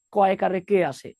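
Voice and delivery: ordinary delivery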